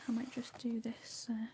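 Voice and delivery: hushed ASMR whisper. Acted. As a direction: whisper gently, as if not to break the calm. Whispered ASMR colouring chat.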